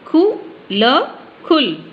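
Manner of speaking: slow खु ल split apart, then खुल joined; teacher sounding out खु ल before saying खुल; sounding out, then blending. Shown as a demonstration. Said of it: "इनको मिला के पढ़ेंगे".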